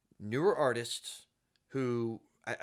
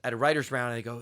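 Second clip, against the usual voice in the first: ordinary talk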